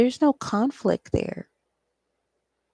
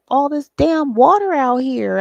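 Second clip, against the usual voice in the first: normal talking voice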